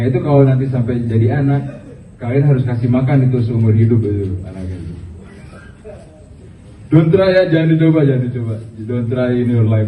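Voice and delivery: ordinary delivery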